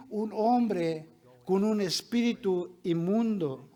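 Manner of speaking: usual speaking voice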